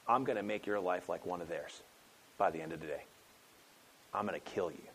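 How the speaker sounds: hint of a threatening queen, low; mad queen threat. Jezebel threatens Elijah, lightly voiced low menace. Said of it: "she was mad".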